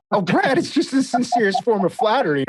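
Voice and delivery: high playful teasing; cheeky. Retold or imitated. Cheeky retort, high far voice.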